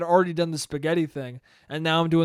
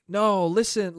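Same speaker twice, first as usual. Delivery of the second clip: ordinary talk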